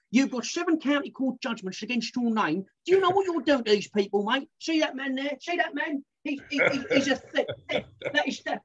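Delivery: high-pitched indignant presenter telling off a rogue; Dominic Littlewood scolding. Acted. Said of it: "he has a list of people".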